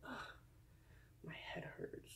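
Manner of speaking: a hushed, pained complaint; groaning whisper about a headache. Acted. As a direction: whisper it with a groan, head aching. Groaning aside, unvoiced.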